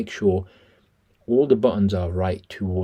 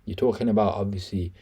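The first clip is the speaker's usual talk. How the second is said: ordinary talk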